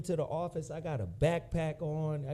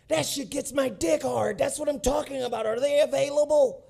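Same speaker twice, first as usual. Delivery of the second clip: higher, loud, thrilled retelling of the boss; excited shout; 8 semitones higher, 5 dB louder than usual. Retold or imitated. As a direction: shout it like the boss loving the tape. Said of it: "He was like".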